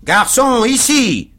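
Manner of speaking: very high, loud yell for service; howled summons. A narrator's reading. Stranger violently rings for the waiter, howling. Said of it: "hurla l'inconnu".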